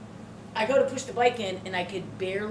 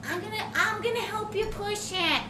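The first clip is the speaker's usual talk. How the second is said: much higher little mom voice; imitated elderly mom; 12 semitones higher than usual. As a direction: pitch it way up, insisting like mom. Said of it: "She's like".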